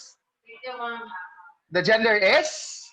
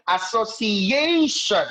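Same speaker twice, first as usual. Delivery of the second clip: "Association" slowly, syllable by syllable, landing on A; stress on later syllable; slower than usual. Shown as a demonstration. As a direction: stretch as-so-ci-A-tion, leaning on the A. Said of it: "fourth to fifth syllable".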